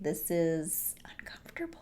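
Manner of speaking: hushed, whispered aside; uneasy whisper. Retold or imitated. Viewer reacting to the creepy film, uneasy whisper. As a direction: drop to an uncomfortable whisper.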